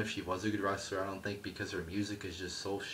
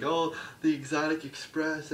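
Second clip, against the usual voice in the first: high, loud goofy fan cheer; mocking the crowd's excitement; 9 semitones higher, 6 dB louder than usual. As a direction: squeal it like a giddy fan. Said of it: "everybody's like".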